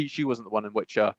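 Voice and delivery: normal talking voice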